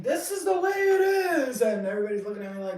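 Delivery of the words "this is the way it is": high, loud screamed proclamation; street-preacher scream. Retold or imitated. Philosopher screaming at people on the street. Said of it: "in the middle of the street screaming".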